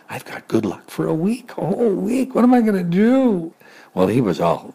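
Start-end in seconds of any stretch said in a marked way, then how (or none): 0.1-3.5 rising high giddy joy; thrilled. Acted. Pig gloats over his good luck, thrilled. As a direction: squeal with glee.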